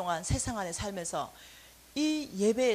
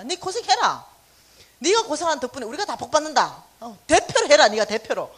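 teasing family; 3 semitones higher, 11 dB louder than usual. Retold or imitated. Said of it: "family teasing her".

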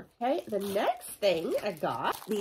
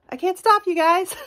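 higher, louder, giddy repeat; excited shout; 11 semitones higher, 9 dB louder than usual. Real, not acted. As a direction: shout it out, laughing at yourself. Repeats it louder, laughing confession.

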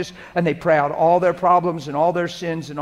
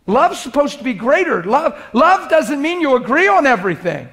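higher and louder, driving each line home; full-force preaching; 9 semitones higher, 8 dB louder than usual. Acted. Pastor to the church on marriage, preaching full force. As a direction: preach it out to the room with fire.

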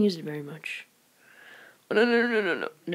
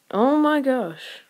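exasperated groan; 7 semitones higher, 3 dB louder, much slower than usual. Real, not acted. Player groans at a bad shot.

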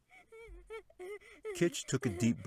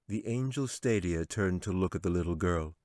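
plain reading voice

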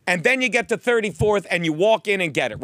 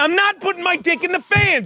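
higher, indignant protest; exasperated protest; 9 semitones higher than usual. Real, not acted. To Steve, indignant high protest. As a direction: push back hard on the joke.

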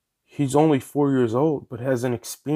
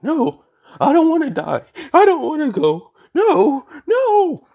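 high, loud desperate scream of terror; panicked screaming; 6 dB louder than usual. Acted. Deku begs the others as the portal pulls him in, screaming in panic. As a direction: shriek it like you're being dragged to your death.

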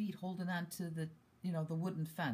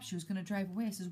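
normal talking voice

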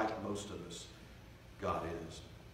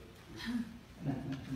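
airy laugh; 13 semitones higher, 4 dB quieter than usual. Real, not acted. Laugh at the story.

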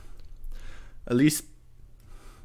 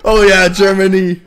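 ordinary talk

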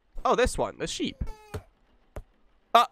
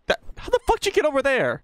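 startled disbelief; 10 semitones higher, faster than usual. Real, not acted. Baby suddenly somewhere else.

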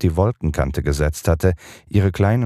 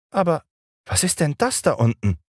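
put-on high surprised boyish question; sudden call; 12 semitones higher than usual. A narrator's reading. John suddenly spots something below, high surprised call. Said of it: "rief John plötzlich".

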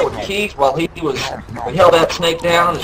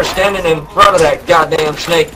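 ordinary talk

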